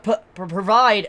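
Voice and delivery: imitated stammer on the first sound; slight stutter. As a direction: catch on the opening P a couple of times. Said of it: "he stutters a little bit".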